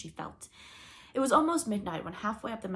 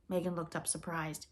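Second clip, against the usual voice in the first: usual speaking voice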